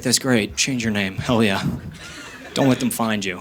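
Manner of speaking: low, soft grandma aside; lightly voiced grandma, kept low; quietly conspiratorial mock grandma; imitated grandma. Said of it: "my grandma was like".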